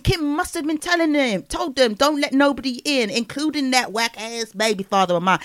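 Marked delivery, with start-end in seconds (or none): none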